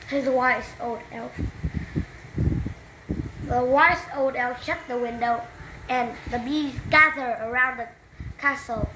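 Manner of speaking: ordinary delivery, nothing marked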